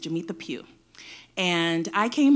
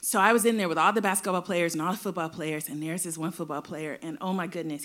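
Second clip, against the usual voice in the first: ordinary delivery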